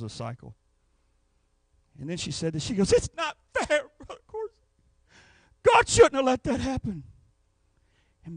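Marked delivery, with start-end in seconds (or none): 2.9-7.0 high, breaking plea; imitating tearful complaint. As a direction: cry out the unfairness. Crying woman complains to pastor.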